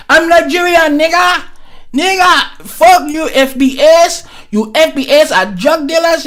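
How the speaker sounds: hammed-up taunting Nigerian voice, ending in a shout; mock Nigerian heckler. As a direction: sneer at them as a Nigerian would. Mocking a Nigerian heckling FBA.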